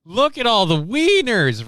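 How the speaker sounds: high, loud, drawn-out mock hoot; hammed-up gleeful classroom yell; mock juvenile hollering. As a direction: holler it like a rowdy schoolboy. Acting out rowdy classmates.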